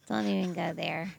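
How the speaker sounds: ordinary delivery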